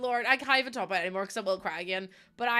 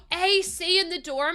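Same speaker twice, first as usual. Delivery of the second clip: gasping delight; 8 semitones higher, 6 dB louder than usual. Real, not acted. Reading a comment with delight.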